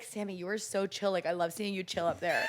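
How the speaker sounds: soft, low friend's voice, quoted; chill compliment. Retold or imitated. Friend loves seeing comedian chill on stage, mellow. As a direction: imitate gentle, mellow praise.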